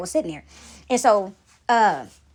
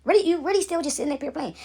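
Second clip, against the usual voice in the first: incredulous; 6 semitones higher than usual. Retold or imitated. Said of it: "I'm looking at her like".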